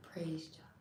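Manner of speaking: very soft, private echo; hushed praise. Real, not acted. Repeating the psalm's praise to herself, hushed. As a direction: murmur it under your breath after reading.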